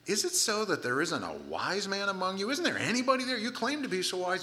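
high-pitched mock disbelief; sarcastic, rising astonishment; sarcastic challenge. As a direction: needle them with incredulous rhetorical questions. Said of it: "I mentioned the sarcasm in here".

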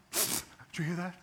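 high, slow, alert mom voice; startled mom. Acted. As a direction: play a mother suddenly hearing the baby. Voicing a mom woken at night.

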